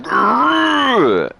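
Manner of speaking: high, loud mock growl of angry teammates; hammed-up growl; mock aggressive growl. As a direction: growl it like a fired-up jock. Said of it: "people were like".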